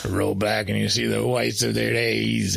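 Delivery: put-on low Quint accent; dark and low in Quint's accent; lightly voiced Quint accent; Quint accent impression. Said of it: "the Quint accent".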